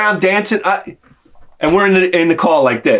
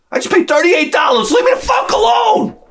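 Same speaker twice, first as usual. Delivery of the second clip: outraged whining yell. Retold or imitated. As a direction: wail it like you've been robbed. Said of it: "I'm like".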